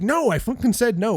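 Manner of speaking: high-pitched exasperated denial; Danny. Retold or imitated. Accused housemate answering his suspicious friends, retold exasperated protest.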